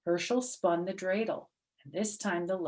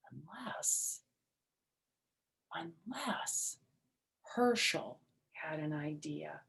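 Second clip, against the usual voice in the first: hushed, drawn-out whisper; suspenseful whisper; 3 semitones lower, 9 dB quieter, slower than usual. A narrator's reading. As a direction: lean in and whisper the twist. Suspenseful narration.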